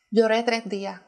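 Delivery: ordinary talk, nothing marked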